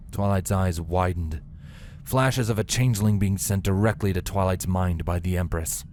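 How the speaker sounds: plain reading voice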